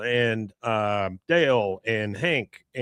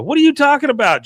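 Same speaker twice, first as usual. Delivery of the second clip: high, loud, fast mock indignation; indignant outburst; 7 dB louder, faster than usual. Hank snapping back at Bobby, hammed-up indignation. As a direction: squawk it like an outraged dad.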